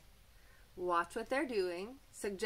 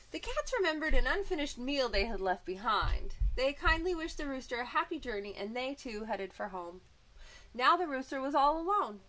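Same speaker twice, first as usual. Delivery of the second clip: bright, lively storybook voice; reading to children; 5 semitones higher, 3 dB louder than usual. A narrator's reading. Teacher reading a picture book to children, lively. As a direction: read it like a teacher to little kids.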